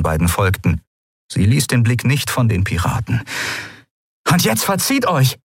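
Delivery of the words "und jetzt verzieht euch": higher, firm command; commanding dismissal. Acted. Endriel dismissing the pirates, firm command. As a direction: send them off with authority. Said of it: "befahl Endriel".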